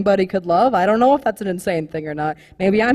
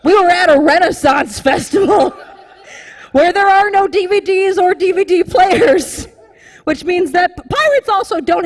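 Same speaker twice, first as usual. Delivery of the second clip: laughing loud; 8 semitones higher, 4 dB louder than usual. Real, not acted. Cracking up at the punchline.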